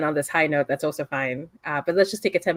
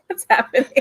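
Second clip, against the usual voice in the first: higher-pitched baffled question; puzzled, raised-pitch outburst; bewildered bystander; 7 semitones higher, 3 dB louder than usual. Acted. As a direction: play a confused onlooker in the background. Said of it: "Ava's, like, in the background going".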